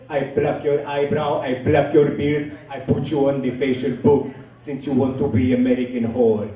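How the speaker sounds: threatening put-on foreign accent; Abdul. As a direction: list punishments evenly in a thick foreign accent. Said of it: "his name is Abdul".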